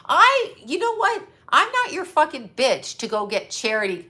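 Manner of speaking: normal talking voice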